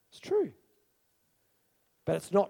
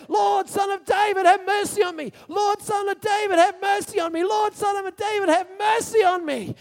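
shouted plea, much higher and louder; crying out for his life; 9 semitones higher, 5 dB louder than usual. Acted. Blind beggar shouting over the crowd to Jesus, desperate. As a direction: scream it like your life depends on it. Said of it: "he was crying out for his life".

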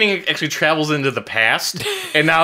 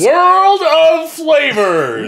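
high, very drawn-out, ringing announcer call; hammed-up announcer; 12 semitones higher, 4 dB louder, much slower than usual. Echoing the brand name for laughs, hammed-up high announcer. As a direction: belt it out like a showman, stretched long.

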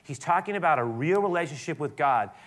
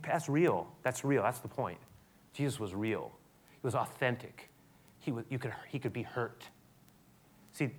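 usual speaking voice

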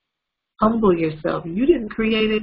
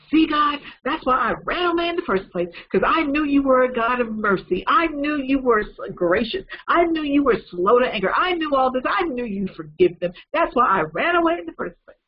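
higher, heated complaint to God; angry Jonah; 6 semitones higher than usual. Acted. Jonah complains angrily to God that he knew it. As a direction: let the grievance boil over as Jonah. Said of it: "Jonah got angry".

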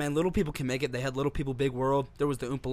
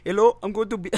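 put-on Indian accent; 5 semitones higher, 4 dB louder than usual. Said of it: "He's Indian, so he's on a Bluetooth".

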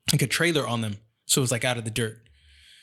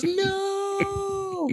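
put-on high child's no; sister's high distraught no; child's wail. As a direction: whine it like a little girl. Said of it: "and she goes".

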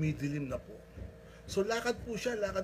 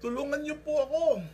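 high, loud cry for rescue; desperate plea; 12 semitones higher, 7 dB louder than usual. Retold or imitated. Man on the branch begs God in a high, loud cry. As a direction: beg loudly from far below.

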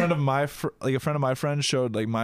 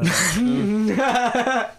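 emphatic no; 10 semitones higher, 6 dB louder than usual. Real, not acted. Emphatic denial of a guess.

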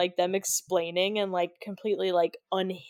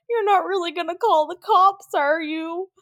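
higher, anxious little whine; nervous pleading; 9 semitones higher, 5 dB louder than usual. Retold or imitated. Stu nervously asking Billy, higher mock whine. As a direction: beg with a worried quaver.

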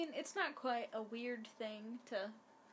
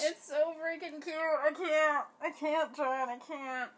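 gushing; 5 semitones higher, 9 dB louder, slower than usual. Real, not acted. Gushing over a cute scene.

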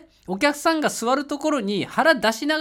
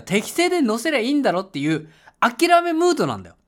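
ordinary talk

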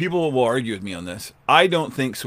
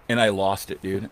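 ordinary talk

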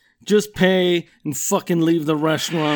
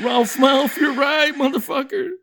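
much higher, frantic pleading voice; scared sidekick; 8 semitones higher than usual. Retold or imitated. Fonzie's friends apologizing to him in terror, high frantic pleading. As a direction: grovel fast and scared.

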